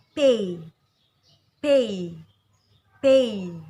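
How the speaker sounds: "pay" with a loud, clear ei glide; clear ei diphthong. Shown as a demonstration. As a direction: slide from e to i in "pay". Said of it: "Seperti dalam kata". (ucapkan "pay" dengan bunyi ei yang jelas)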